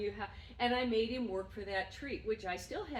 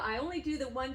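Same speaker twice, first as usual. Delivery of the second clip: normal talking voice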